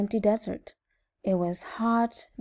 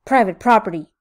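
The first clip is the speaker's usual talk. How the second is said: loud, grumpy warning; storybook yell, hard and loud; 9 dB louder, faster than usual. A narrator's reading. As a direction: yell it at a trespasser. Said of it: "yelled Hank".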